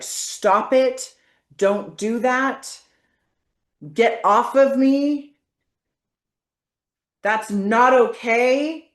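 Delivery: firm, loud, slow commands; forceful demo. Shown as a demonstration. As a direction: push them away with your voice. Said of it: "say this with force".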